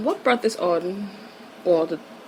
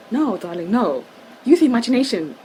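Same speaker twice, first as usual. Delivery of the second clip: exclaimed retort, rising higher and louder on "use imagination"; mock reproach; 4 semitones higher, 5 dB louder than usual. Answering amateurs who think bed's the only place, mock reproach. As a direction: chide a darling, building to an emphatic push. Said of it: "and I'm like".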